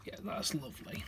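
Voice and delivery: breathy whispered approval; whispering. Real, not acted. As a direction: whisper it softly to yourself. Whispered comment next to plain take.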